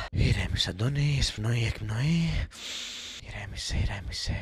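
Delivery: self-calming. Real, not acted. Calming himself down with breathing.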